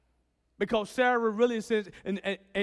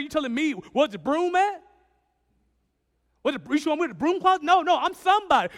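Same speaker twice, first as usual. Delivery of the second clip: higher, louder, a puffed-up man boasting; boastful big shot; 5 semitones higher, 5 dB louder, faster than usual. Retold or imitated. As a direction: show off like a self-important somebody. Voicing a proud churchgoer.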